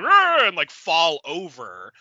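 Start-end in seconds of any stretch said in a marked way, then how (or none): 0.0-0.4 sudden high loud roar; mock monster roar. Pitching a film scene, the monster roars, hammed-up roar. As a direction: belt out a silly monster roar. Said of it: "have the monster scream and be like".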